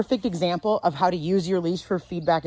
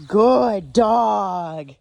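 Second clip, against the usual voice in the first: warm, loud praise for the dog; 2 semitones higher, 6 dB louder than usual. Real, not acted. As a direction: reward him out loud.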